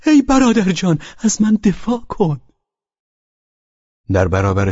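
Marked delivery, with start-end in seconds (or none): 0.1-2.4 put-on high, louder drunken wail; desperate cry for help. A narrator's reading. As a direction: cry out as if your throat were being cut.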